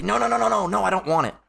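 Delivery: a hammed-up childish no; stubborn refusal. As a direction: play the stubborn believer pushing truth away.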